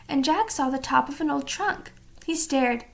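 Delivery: ordinary delivery, nothing marked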